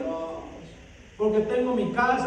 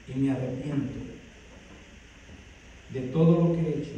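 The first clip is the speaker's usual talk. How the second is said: softer, lower repentant plea under music; Samson's humble prayer; 9 semitones lower, 8 dB quieter than usual. Acted. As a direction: pray softly, like a broken man asking forgiveness. (súplica arrepentida, más suave y grave)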